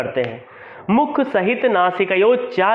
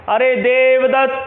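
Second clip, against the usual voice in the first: loud call, higher, with "अत्र आगच्छ" slow and stretched; calling from afar; 4 semitones higher, 3 dB louder, slower than usual. Shown as a demonstration. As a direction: call someone standing far away, drawing out the name. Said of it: "उसको हम तेज बोलेंगे".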